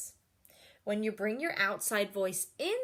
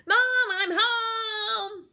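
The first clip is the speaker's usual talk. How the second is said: high, slow, loud call; 11 semitones higher, 8 dB louder, much slower than usual. Acted. Girl coming home to her mother, calling loud. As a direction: call out to the whole house.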